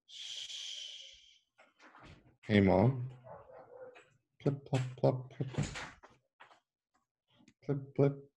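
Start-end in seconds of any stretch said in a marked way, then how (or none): 4.4-8.3 high light plip of drops; dripping raindrops. A narrator's reading. Reading to kids: high soft raindrop plips. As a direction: drip the words like rain.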